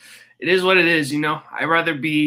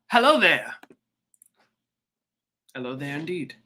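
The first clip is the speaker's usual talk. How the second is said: a light mock British accent, fading softer; 3 semitones lower, 5 dB quieter than usual. Streamer greeting a commenter in a light mock British accent. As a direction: put on a hint of a posh British greeting. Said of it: "I'm speaking in a British accent".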